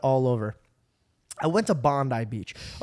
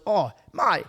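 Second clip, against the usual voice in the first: loud, high, hammed-up Aussie accent; over-the-top Aussie yell, stretched out; mock Australian cousin; 6 semitones higher, 5 dB louder, much slower than usual. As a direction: holler it like a cartoon Australian. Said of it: "he doesn't speak like that".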